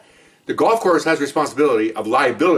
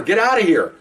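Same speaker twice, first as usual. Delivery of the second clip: yelling; 8 semitones higher than usual. Retold or imitated. Partner yelling at his wife to get out, raised voice. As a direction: shout her off the course. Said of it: "now he's yelling at her".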